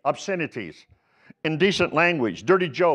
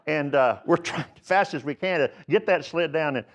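ordinary talk, nothing marked

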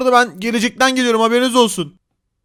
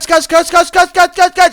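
louder, higher repeated run; urgent run cry; 6 semitones higher, 6 dB louder, slower than usual. Acted. Player flees the cavemen, shouting run. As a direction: urge himself to flee.

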